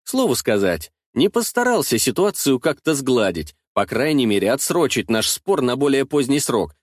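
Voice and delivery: ordinary delivery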